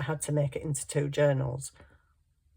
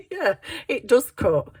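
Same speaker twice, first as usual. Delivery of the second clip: laughing; 7 semitones higher, 8 dB louder than usual. Real, not acted. Laughs about cut finger.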